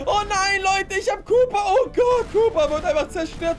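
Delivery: high, loud panicked cries, near tears; panicked shouting. Acted. Gamer watching rockets wreck his car, high panicked shouting. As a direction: shriek in horror as everything explodes.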